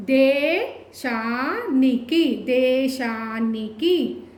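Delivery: very slow "దే" and "శా" held long in "దేశానికి"; drawn-out long vowels. Shown as a demonstration. Teacher having learners say it twice first, slow stretched vowels. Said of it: "పదాన్ని ఒకటికి రెండు సార్లు పలకండి".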